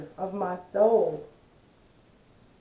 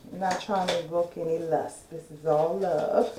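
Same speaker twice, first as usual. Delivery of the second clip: usual speaking voice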